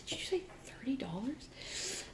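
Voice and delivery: hushed whisper, rising question. Retold or imitated. Guest checks the price with the clerk, whispering. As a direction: whisper the question so nobody hears.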